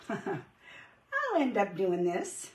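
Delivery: slow words broken by laughter; laughing through words. Real, not acted. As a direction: laugh as you say it. Laughing at her own mess.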